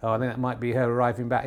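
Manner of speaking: normal talking voice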